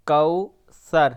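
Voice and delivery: کوثر said slowly as kau-sar; teacher sounding out a Quranic word; slow careful recitation. Shown as a demonstration. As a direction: stretch کوثر into two clear syllables. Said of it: "کاف زبر واو".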